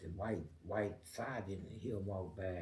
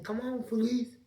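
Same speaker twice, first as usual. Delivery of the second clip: retold call; 11 semitones higher, 6 dB louder than usual. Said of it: "They said".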